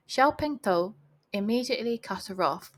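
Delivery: plain reading voice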